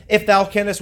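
IF hit loud in "If thou canst"; pointed tone. Retold or imitated. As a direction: quote it loudly, punching the opening "If". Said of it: "had a tone about himself".

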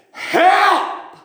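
one very high, sustained cry; reenacted plea, pitched far up and held; cry for help. Preacher acts out Jesus calling to God, high cry for help. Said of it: "too proud to cry out for help".

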